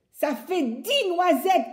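high, loud, slow outraged shout; woodcutter shouting. Acted. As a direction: cry out counting the nuts. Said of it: "crie le bûcheron". (cri aigu et indigné du bûcheron)

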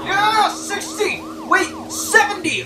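excited. Real, not acted. Speeding excitedly.

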